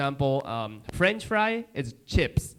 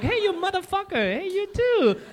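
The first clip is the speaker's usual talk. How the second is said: Chicago street greeting. Retold or imitated. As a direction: greet a buddy crudely. Chicagoans quoted.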